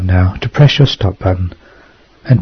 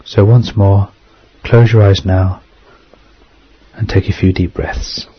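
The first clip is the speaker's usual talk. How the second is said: guided meditation. Acted.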